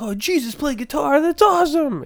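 high-pitched, awestruck gushing; mock starstruck squeal; excited exclamation. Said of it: "I'm like".

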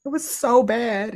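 high, emphatic condemnation; slow, high and heartfelt outrage; real upset. Real, not acted. Upset condemning what was done.

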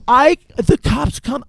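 ordinary delivery

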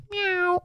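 high, soft mock meow; cat meow. Cat backs away then meows, high soft imitation. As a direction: mimic a cat meowing from out of reach. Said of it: "and then he'll go".

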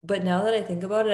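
ordinary talk, nothing marked

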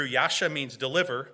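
ordinary talk, nothing marked

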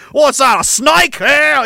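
high, eager mock Australian; hammed-up excited Aussie; Australian accent. Settler delighted to find a snake to fight, Australian accent.

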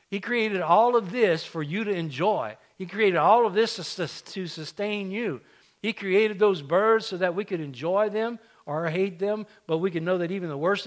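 fervent preaching. Acted. Preaching climax.

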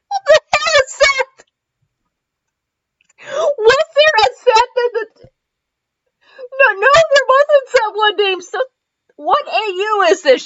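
high, incredulous outburst; baffled. Real, not acted. Host reading fanfic, high and baffled by Seth. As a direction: disbelief at a character she never met.

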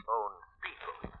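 hushed menacing whisper. Acted. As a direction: breathe it out like a threat. Shadow signs off menacingly.

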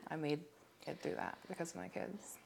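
fighting back tears as it fades; holding back tears. Real, not acted. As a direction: voice dropping away, close to tears. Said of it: "I'm trying not to cry right now".

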